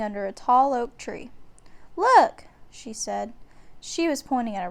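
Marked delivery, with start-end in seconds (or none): 2.0-2.4 high, louder eager look; a girl thrilled by a discovery; excited. A narrator's reading. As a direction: point it out with glee. Annie excited at the ladder.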